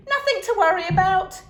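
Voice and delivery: put-on high loud small-boy voice; little boy agreeing. A narrator's reading. Youngest son agreeing, high boyish voice. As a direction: pipe up eagerly. Boy's voice.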